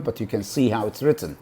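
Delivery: normal talking voice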